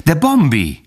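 high-pitched friendly reproach; reproachful, familiar cry. A narrator's reading. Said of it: "kiáltotta szemrehányóan és bizalmasan".

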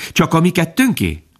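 put-on high, eager child's question; high childlike fawn voice; little fawn voice. A narrator's reading. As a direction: ask like a small curious fawn. Little fawn asks his mother.

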